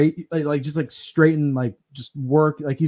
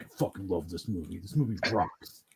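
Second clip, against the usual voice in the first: lower, much softer gruff-dad impression; imitating dad; 7 semitones lower, 10 dB quieter than usual. Son imitating his dad praising a movie, low soft voice. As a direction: mumble it low like an old dad. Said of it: "my dad's like".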